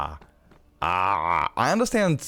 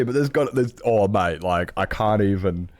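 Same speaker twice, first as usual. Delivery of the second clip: ordinary talk, nothing marked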